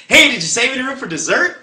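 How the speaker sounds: high, loud singsong waitress pitch; chirpy waitress. Retold or imitated. Waitress beaming at a diner with a sales pitch. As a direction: sell the dessert with a big bright pitch.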